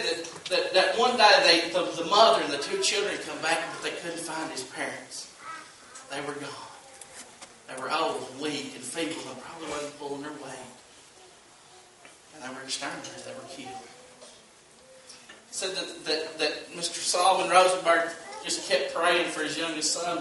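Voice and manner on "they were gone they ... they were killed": hushed, low and grave; softly solemn, dropping almost away; somber. A narrator's reading. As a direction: let the voice sink in grief. Somber retelling of family's killing.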